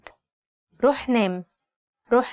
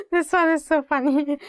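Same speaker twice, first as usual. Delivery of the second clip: higher-pitched, giggly delivery; amused laughing; 5 semitones higher than usual. Real, not acted. Teacher tickled by the next phrase, laughing as she speaks. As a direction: say it through a real laugh.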